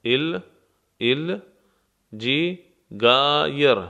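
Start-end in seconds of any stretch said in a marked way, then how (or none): 2.2-3.8 جی، گایر broken into syllables with a hard g sound, slow; slow جی، گایر to show the g letter; split syllables. Shown as a demonstration. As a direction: separate جی and گایر and stress the g sound. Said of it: "گی، حرف گی".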